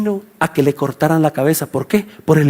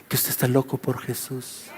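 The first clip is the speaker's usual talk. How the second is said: very soft, low confiding line; low, quiet punchline; hushed reveal; 8 semitones lower, 14 dB quieter than usual. Real, not acted. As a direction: drop right down and confide it. Preacher drops voice for the answer.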